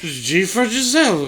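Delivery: slurred, slow and loose; drunk slur. Acted. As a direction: say it like you're tipsy. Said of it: "I said that like a drunk".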